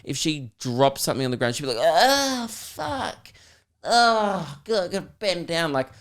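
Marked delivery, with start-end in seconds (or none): 1.8-3.3 whiny brat imitation, high then fading; bratty private-school chick. Bratty girl who dropped something on the ground, exasperated groan. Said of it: "bratty, private school chick". 3.8-5.7 high, drawn-out put-upon groan; bratty private-school chick. Acted. Bratty girl having to bend down, groaning complaint. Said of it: "bratty, private school chick".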